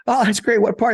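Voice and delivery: high, lively mock dad voice; imitating the dad. Dad greeting a fellow Hoosier, in a high mock voice. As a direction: pitch it way up like the excited father. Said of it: "he said".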